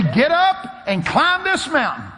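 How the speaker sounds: booming command, high and drawn out; the Lord. Retold or imitated. Preacher voices God ordering him uphill, booming command. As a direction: thunder the order slowly. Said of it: "He said to me".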